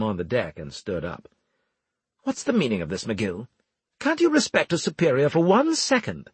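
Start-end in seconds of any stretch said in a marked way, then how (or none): 2.2-6.3 indignant, building to a high squawk; bristle, then snap at a subordinate; annoyed superior's high-pitched protest; alarmed, annoyed. A narrator's reading. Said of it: "Alarmed and annoyed".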